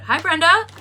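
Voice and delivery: high, loud cheerful hello; bright greeting. Real, not acted. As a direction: greet a viewer warmly. Greeting a viewer who joined.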